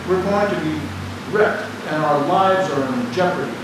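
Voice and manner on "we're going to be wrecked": ordinary delivery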